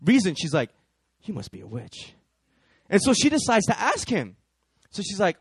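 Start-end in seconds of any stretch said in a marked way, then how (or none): 1.2-2.1 low, hushed suspicion; inner thought. Acted. Woman silently judging the rude man, low and hushed. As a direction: drop right down as if thinking it privately. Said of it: "it's in her head".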